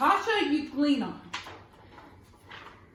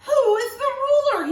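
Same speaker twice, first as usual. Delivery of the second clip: high and loud, almost crying; anxious cry; 10 semitones higher, 8 dB louder than usual. A narrator's reading. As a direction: cry it out in alarm. Gasp, then crying question.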